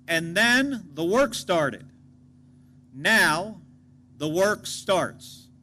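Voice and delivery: slow emphatic delivery. Real, not acted. Contrast then and now.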